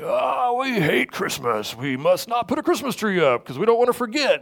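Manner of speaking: high-pitched, overwrought mock protest; mock outrage. Preacher mimics Christians swearing off Christmas trees, mock outrage. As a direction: parody an overzealous objector, voice swooping high.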